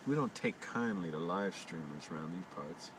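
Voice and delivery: put-on hushed Old West townsman bit; mock western local. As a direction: play a wary small-town local, trailing down quietly. Mock western local warning off streamers.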